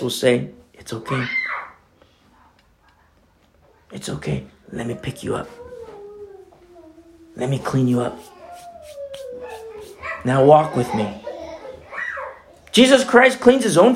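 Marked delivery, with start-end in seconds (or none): 0.7-11.2 gentle reassurance. Acted. Preacher voicing Jesus comforting the fallen, gentle reassurance. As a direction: reassure quietly, with long pauses.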